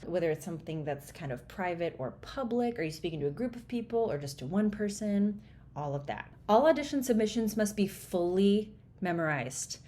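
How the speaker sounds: ordinary delivery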